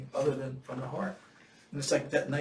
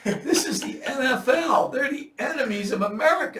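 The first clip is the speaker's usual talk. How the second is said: much higher and louder, heated mock tirade; retold angry rant; 9 semitones higher, 11 dB louder than usual. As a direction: rant at him like an outraged patriot.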